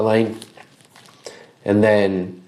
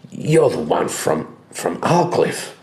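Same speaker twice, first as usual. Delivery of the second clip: gruff butcher voice. Acted. GM voices Grimwit questioning Dalius.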